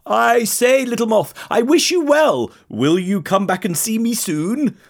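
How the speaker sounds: high, well-wishing giraffe voice; friendly giraffe. A narrator's reading.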